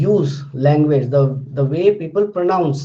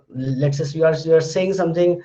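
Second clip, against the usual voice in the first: ordinary talk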